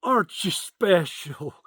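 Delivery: lightly voiced sweet old lady, slow; a hint of a syrupy Southern grandma, drawn out. Old Southern lady sizing him up, lightly mimicked slow and sweet. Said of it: "little old Southern lady comes up to me and says".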